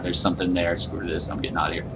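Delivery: hushed and tense, dropping way down; frightened near-whisper; scared hush. Real, not acted. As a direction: keep it low so the thing can't hear. Hiding from a monster.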